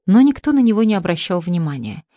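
ordinary delivery

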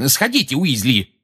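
high-pitched, curt command; barked order. A narrator's reading. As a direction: order the assistant off sharply. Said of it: "гаркнул Фадж".

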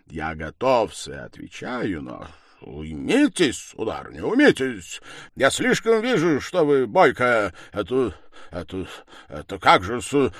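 halting protest with sudden loud words; flustered muttering. A narrator's reading. Accuser asking the raging woman to calm down, flustered protest. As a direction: stumble through an uneasy objection. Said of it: "бормотал Лужин".

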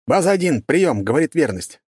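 radio call. Acted.

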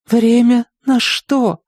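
slow, high, broken plea; queen asking her husband through tears; tearful question. A narrator's reading. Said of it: "сквозь слёзы посмотрела".

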